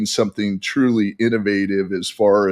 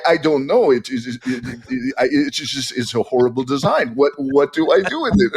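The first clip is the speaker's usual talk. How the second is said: light put-on French accent, complaining; mock French accent, fussing over the tea table; 3 semitones higher than usual. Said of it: "See, I can't, I can't help myself".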